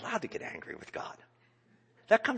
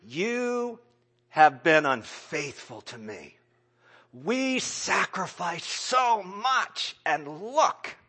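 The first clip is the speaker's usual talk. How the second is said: loud, higher, slow reproach; crying out at God, wounded and loud; accusing God; 6 semitones higher, 7 dB louder, slower than usual. Retold or imitated. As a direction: hurl the charge upward. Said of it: "But not in that moment".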